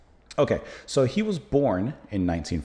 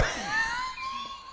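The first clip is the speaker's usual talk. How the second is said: burst of laughter; 6 dB quieter than usual. Real, not acted. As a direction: break into a self-mocking laugh. Laughs at own ignorance.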